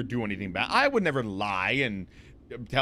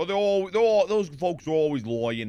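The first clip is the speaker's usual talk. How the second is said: higher, louder mock Brooklyn accent; weird Brooklyn accent; 4 semitones higher, 3 dB louder than usual. Streamer demonstrating the accent he slipped into, mock Brooklyn accent. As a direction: put on a comic Brooklyn accent. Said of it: "like, Brooklyn accent of some sort".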